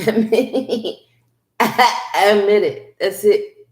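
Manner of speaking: laughing, Admit it with admit stressed; laughing urge. Shown as a demonstration. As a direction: tease admit it through a laugh. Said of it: "playful repeated urging".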